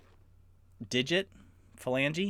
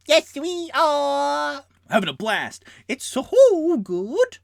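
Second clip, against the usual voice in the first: much higher, loud, slow rats; happy rats cheering; rat chorus voice; 9 dB louder, slower than usual. Acted. Rats answer.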